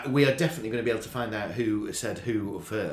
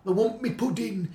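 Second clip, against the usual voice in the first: granddad voice; 8 semitones higher than usual. Retold or imitated. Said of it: "I always remember the granddad".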